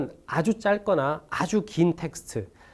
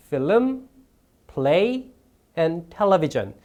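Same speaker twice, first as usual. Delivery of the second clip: FILM up, PLAY up, TELEVISION down; list intonation; much slower than usual. Shown as a demonstration. Teacher showing test takers the list tune, rising, rising, falling. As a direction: raise "film" and "play", let "television" fall. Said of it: "억양을 올리고 올리고 내려주는 것들은".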